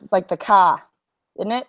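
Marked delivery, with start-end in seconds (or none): 0.4-0.9 "ka" for car, no R; dropped R. Shown as a demonstration. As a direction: say "ka" with an open Boston vowel. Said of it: "they drop the R's".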